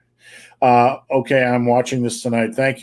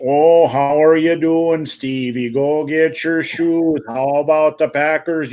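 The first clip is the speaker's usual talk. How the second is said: put-on aunt's drawn-out, higher Wisconsin accent; hammed-up Wisconsin relative greeting a nephew; 4 semitones higher, slower than usual. Nephew mimics relatives greeting him, mock Wisconsin accent. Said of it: "that's literally how my aunt talked".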